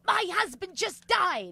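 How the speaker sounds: retold anger. Widow telling the comedian of her loss, imitated anger. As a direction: act out her indignant reveal. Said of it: "she was fucking angry".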